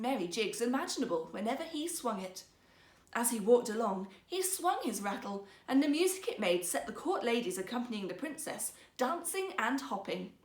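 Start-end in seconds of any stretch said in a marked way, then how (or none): none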